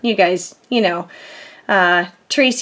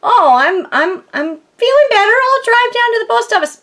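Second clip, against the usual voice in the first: mock chipper self; 10 semitones higher than usual. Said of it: "Saturday I thought".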